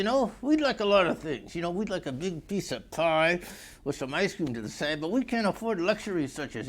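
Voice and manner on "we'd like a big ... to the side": ordinary delivery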